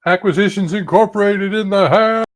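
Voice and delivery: high, hyped-up hollering announcement; yell. Acted. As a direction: yell it out like a rallying cry. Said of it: "will yell".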